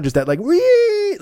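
long squealing falsetto wee; high-pitched screech. Retold or imitated. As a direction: screech like a monster printer. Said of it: "like that really high pitch".

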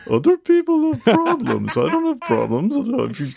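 Grant's dog voice. Retold or imitated. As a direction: bark it out as the family dog. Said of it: "That's Grant's voice".

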